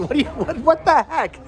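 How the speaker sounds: ordinary talk, nothing marked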